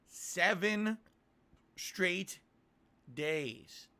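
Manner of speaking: dramatic announcement, each word set apart; show host announcer. Acted. Host announces seven straight streaming days, emphatic announcer style. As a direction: punch out each word with big pauses.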